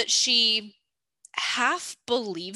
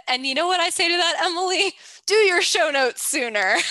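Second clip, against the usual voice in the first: playful dig, higher and louder; mock passive aggressive; 9 semitones higher, 6 dB louder than usual. A host teases Emily about late show notes, mock passive-aggressive. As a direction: needle your co-host with a mock-pointed jab. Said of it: "I'm feeling passive aggressive today".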